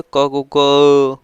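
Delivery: का said slowly and loudly with a heavy throaty क, as Persian speakers would; heavy Persian sound. Shown as a demonstration. Teacher demonstrates the Persian nukta sound to students, slow and loud. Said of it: "अब फारसी क्या बोलते थे?".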